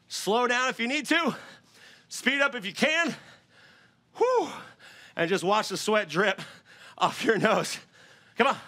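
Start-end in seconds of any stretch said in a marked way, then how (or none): 4.2-4.5 high whew exhale. Real, not acted. Exhaling after a hard set.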